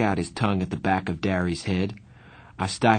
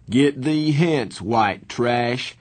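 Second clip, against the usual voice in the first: a hint of a snob, higher and slow; snobbish voice; 4 semitones higher, much slower than usual. A narrator's reading. Two-Bit plays a snooty rich kid for his friends. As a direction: lightly sneer down your nose. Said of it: "in a snobbish voice".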